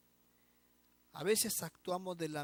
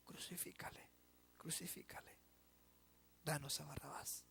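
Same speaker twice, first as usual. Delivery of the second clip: unvoiced whisper, much softer; susurro siniestro; 12 dB quieter than usual. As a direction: breathe the mob's verdict. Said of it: "para decir". (susurro de la multitud que condena)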